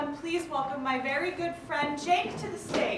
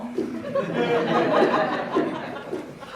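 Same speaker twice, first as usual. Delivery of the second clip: loud breathy laughing gasp; breathless laugh; 9 semitones higher, 8 dB louder than usual. Real, not acted. Exhausted after jumping jacks.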